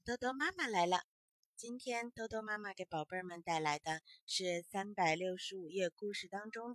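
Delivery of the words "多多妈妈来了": a mother's cheerful hello to little ones; bedtime story greeting. Acted. Mother greets her little ones at bedtime, bright and loud.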